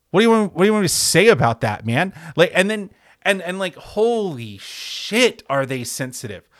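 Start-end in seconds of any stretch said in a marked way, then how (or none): 0.1-2.1 fast, higher, louder what do you want; exasperated reply. Retold or imitated. Host replaying his own baffled answer to a fan, fast and louder. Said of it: "you're like". 3.8-5.4 slow, higher, stretched holy shit; drawn-out holy shit. Real, not acted. Host marveling at fans' sensitivity, slow and higher.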